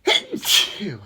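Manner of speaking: loud real sneeze; big explosive sneeze; sneezing. Real, not acted. Sneezing fit continues.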